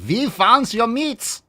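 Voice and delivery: high, loud, drawn-out mock German accent; Nazi soldier. Nazis discovering the hidden meat, gloating mock German accent. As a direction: crow it like a hammed-up German villain. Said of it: "the Nazis are like".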